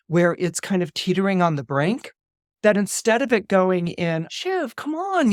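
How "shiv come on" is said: slow, high pleading. Retold or imitated. Brothers beg Shiv to vote, slow pleading. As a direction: drag it out, begging her.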